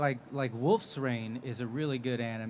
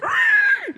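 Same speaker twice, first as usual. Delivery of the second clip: shrieked goblin cry, far higher and much louder; goblin scream; 12 dB louder than usual. Retold or imitated. Hours of goblin noises in the booth, goblin scream. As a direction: scream it like a goblin falling off a cliff. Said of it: "you know, screaming".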